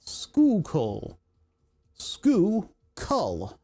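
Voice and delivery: "Schuylkill" broken into two syllables, skoo, kull; slow local pronunciation. Shown as a demonstration. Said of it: "it's pronounced".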